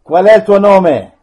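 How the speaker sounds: loud, demanding question; stern command. Real, not acted. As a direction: order it to answer. Priest commanding a spirit.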